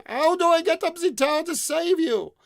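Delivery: very high, loud bellow. Acted. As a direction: yell it up the tower. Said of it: "shouted again".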